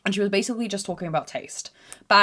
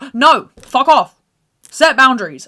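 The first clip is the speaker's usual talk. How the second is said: firm loud refusal; 6 semitones higher, 12 dB louder than usual. Retold or imitated. Daughter refusing mum's photo demand, loud and firm. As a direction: hit each word hard to shut it down. Retold row with her mum.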